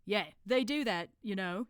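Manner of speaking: slow know-it-all in a put-on accent; knowledgeable. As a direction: explain it like a self-appointed expert. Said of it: "said Brother Watchtower knowledgeably".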